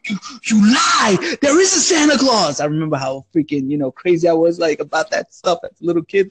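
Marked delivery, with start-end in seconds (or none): 0.1-2.6 higher, slow, shouted denial; kid's outraged yell. Retold or imitated. Kid told Santa is fake, shouting back indignant.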